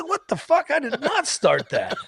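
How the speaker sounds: high-pitched, incredulous denial; mock indignant protest. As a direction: protest your innocence, slowing on the denial. Retold indignant denial of blame.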